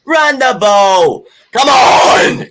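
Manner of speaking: slow, loud, high shouted British accent; horrible British accent. Shown as a demonstration. Cohost asks for it, so he shouts it in a bad British accent. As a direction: ham up a terrible British accent and yell it. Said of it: "say, say it in the British accent".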